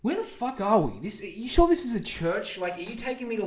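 baffled. Retold or imitated. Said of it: "And I'm like".

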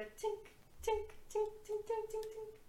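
high, light tinks fading away; rock clinking down. Acted. Game master voices the thrown rock, tinkling downward. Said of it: "throw the rock down and you hear".

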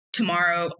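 usual speaking voice

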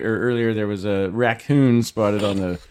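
normal talking voice

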